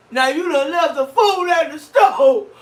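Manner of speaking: put-on high, loud, slow whiny kid voice with a laugh; whiny fat kid. Wife imitating husband whining about food like a fat kid. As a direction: whine like a kid missing his cake. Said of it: "He sounded like a fat kid".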